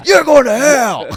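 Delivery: very loud, high mock condemnation; shouted damnation. Comic yelling back at the street preacher, shouted damnation. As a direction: yell it like a street-corner curse.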